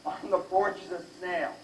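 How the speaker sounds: ordinary talk, nothing marked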